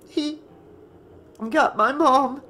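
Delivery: high, halting, upset voice; voice breaking. A narrator's reading. Tearful Tina tells Nick about her mom, voice breaking. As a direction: force it out in broken pieces, high-pitched. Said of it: "her voice breaking".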